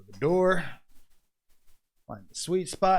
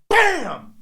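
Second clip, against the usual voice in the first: much higher, louder punchy bam; shouted bam; 13 semitones higher, 6 dB louder than usual. Retold or imitated. Wrestler retelling his knee strike, shouting the impact. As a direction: fire it out like a hit landing.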